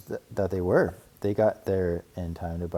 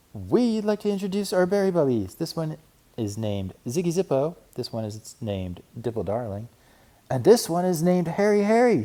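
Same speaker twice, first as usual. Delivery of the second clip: high, eager little cub; sister cub voice; 9 semitones higher, 5 dB louder than usual. A narrator's reading. Sister cub pleading with Papa for money, high and eager. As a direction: play an eager young cub.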